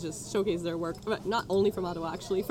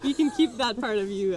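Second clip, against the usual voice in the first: laughing; 7 semitones higher, 7 dB louder than usual. Real, not acted. Laughing through a joke.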